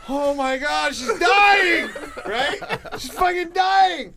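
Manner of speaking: high, loud panicked yell; panicked shout. Retold or imitated. Friend panics at the dad that the girl is dying, shouting.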